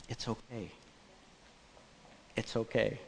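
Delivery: calm the shaken waiter softly; gentle reassurance. Retold or imitated. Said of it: "says to the young person".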